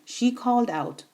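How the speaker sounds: usual speaking voice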